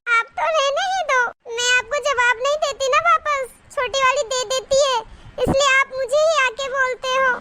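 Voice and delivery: high plaintive protest; sulky whining. Acted. Elder daughter-in-law always asked first, sulky whining. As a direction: complain in a high, put-upon whine.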